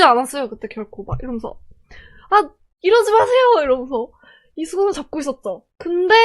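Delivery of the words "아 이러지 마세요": high, loud plea to stop; re-enacted desperate protest; protesting cry. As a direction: cry out while holding on tight. Acts out resisting the grabbers.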